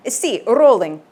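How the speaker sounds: trilled R on rolling, Italian engineer; Italian accent. Acted. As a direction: roll the R like an Italian sound engineer. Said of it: "He trilled the R again".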